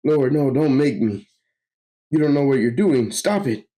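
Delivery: high, urgent begging; alarmed pleading. Acted. Victim begs villain not to force laughter, alarmed. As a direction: plead with him, pitch climbing.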